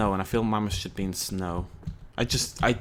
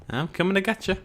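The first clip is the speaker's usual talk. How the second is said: higher, teasing coming-to-get-you; playful sing-song; 8 semitones higher than usual. Pretend. Player teasing his Smilodon, playful sing-song. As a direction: tease the animal like a game of chase.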